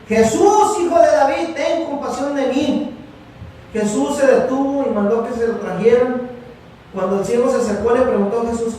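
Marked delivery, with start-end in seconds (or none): 0.1-3.0 even higher, louder plea, slowing at the end; crying out louder. Retold or imitated. Blind man shouting over the crowd that hushes him, louder high cry. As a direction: push the cry harder after being hushed. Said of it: "se puso a gritar con más fuerza".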